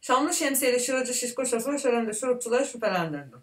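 fast, slurred with a full mouth; cheeks stuffed full. Real, not acted. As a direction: rush the twister through stuffed cheeks. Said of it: "Ya bir yutkunayım bir".

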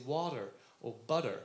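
"water" and "butter" with a D in place of T; American pronunciation of "water", "butter"; American D sound. Shown as a demonstration. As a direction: say water as "wadder", butter as "budder". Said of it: "you say the D".

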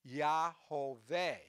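"Yahoveh" drawn out slowly, syllable by syllable; "Yahoveh" in three slow, separate syllables, Yah-ho-veh; demonstrated pronunciation. Shown as a demonstration. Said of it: "those vowels would make it pronounce".

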